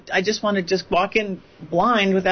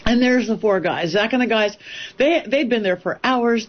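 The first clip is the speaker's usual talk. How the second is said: usual speaking voice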